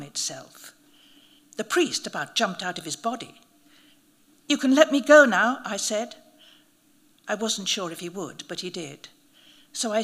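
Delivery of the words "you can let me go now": raised, loud demand; firm command. A narrator's reading. Narrator ordering the priest to release him, loudly. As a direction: tell him to let go, loud and sure.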